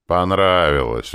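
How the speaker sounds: slow, loud, assured statement; emphatic, self-assured verdict; confident. A narrator's reading. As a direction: declare it firmly, drawing the word out. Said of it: "уверенно заявил четвёртый".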